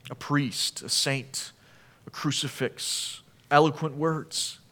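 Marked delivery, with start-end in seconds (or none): none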